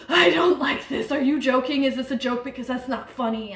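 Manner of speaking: imitating a frightened, breathless rush of questions; hyperventilating panic. Hannah on the couch fearing a joke, hyperventilating.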